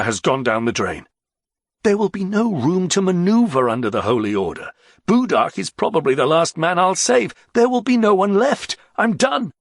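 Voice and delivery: high, agitated outburst. A narrator's reading. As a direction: speak as if all is lost. Agitated speech by a character.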